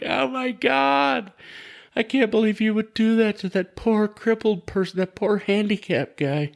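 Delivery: high-pitched, scandalized mock gasp of a crowd; mock shocked onlookers. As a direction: draw out the first words high, then scold in dismay. Acting out shocked onlookers' scolding.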